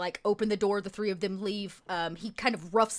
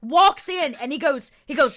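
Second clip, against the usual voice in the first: ordinary delivery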